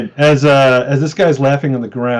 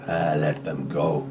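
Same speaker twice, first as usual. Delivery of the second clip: low, slow, softer character voice; dismissive Izek; 8 semitones lower, 8 dB quieter, slower than usual. Acted. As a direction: wave them off in a low, unhurried voice. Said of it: "Izek kinda looks back at you like".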